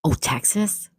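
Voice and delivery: breathy surprised whisper. Real, not acted. Surprised guess at location.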